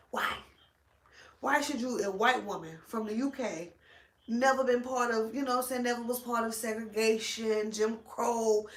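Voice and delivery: ordinary delivery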